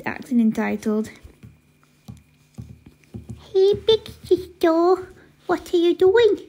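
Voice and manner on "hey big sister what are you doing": put-on high, babyish little-brother voice; high-pitched baby talk. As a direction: talk like a pestering toddler. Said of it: "Why are you talking like a baby?".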